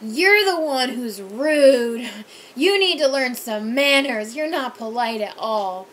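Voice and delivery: loud, slow mock rant; angry drunk. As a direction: hammer each word like an offended drunk. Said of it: "He was like".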